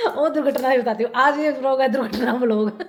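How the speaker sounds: talking through giggles; laughing. Real, not acted. Vlogger to viewers about forgetting her phone, laughing. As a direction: laugh your way into the story.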